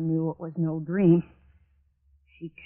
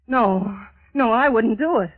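loud, high-pitched protest; panicked refusal; 5 semitones higher, 7 dB louder than usual. Acted. Refusing to go upstairs with the dead woman, panicked. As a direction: refuse with mounting alarm.

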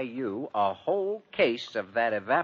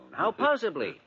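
incredulous; 13 semitones higher, 4 dB louder than usual. Acted. Exasperated high question.

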